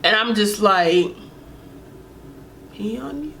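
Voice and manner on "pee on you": soft, breathy disbelieving echo; stunned disbelief. Retold or imitated. Echoing the guy's request in stunned disbelief. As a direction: say it like you can't believe what you heard.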